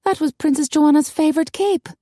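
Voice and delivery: high-pitched mild lament; sighing. A narrator's reading. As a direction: lament it with a sigh in the voice. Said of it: "Maggie sighed".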